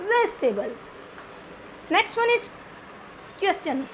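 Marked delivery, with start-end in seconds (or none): 0.1-0.7 Vegetable as "VES-tay-bul", lower; correct pronunciation. Shown as a demonstration. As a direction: drop the middle "ji" from Vegetable. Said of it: "Not vegetable". (Vegetable को "वेजटेबल" बोलें)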